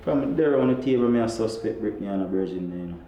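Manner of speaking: low confiding man. Acted. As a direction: confess it to Father.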